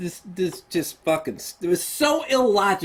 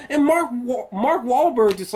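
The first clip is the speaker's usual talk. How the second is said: ordinary talk, nothing marked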